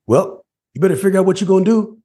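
lightly imitated dad laying it down; dad's voice. Father telling his son to sort it out, lightly imitated. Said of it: "he goes".

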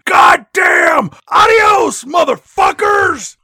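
loud, high full-voiced shout; imitated exterminator's screamed curse; Brad Dourif scream. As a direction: bellow it like an over-the-top movie scream. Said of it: "when you hear Brad Dourif scream".

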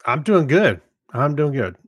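usual speaking voice

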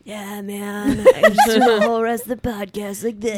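playful mock surfer impression; surfer dude voice. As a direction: put on a goofy surfer-dude voice, half laughing. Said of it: "whole podcast in that voice".

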